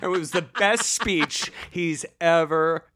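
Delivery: usual speaking voice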